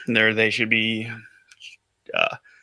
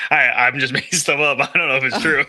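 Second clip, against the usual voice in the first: higher voice with laughter breaking in; laughing admission; 3 semitones higher, 3 dB louder than usual. Real, not acted. Admitting to co-hosts it's made up, laughing. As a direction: own up to it through a laugh.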